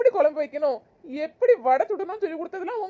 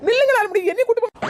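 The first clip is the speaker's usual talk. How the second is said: pleading call; 6 semitones higher, 6 dB louder than usual. Acted. Begging him not to leave.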